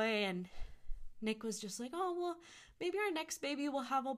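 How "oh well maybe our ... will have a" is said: breezy husband. Retold or imitated. Husband's light reply.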